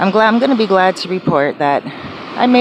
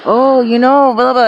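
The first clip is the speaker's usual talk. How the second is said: high, louder mock excited babble; excited chatter; 6 semitones higher, 5 dB louder than usual. Imitating her friend rambling at night, mock eager babble. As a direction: rattle on eagerly. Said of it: "he's like".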